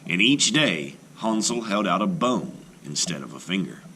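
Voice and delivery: ordinary delivery, nothing marked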